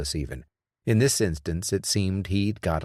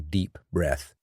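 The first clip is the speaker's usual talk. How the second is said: usual speaking voice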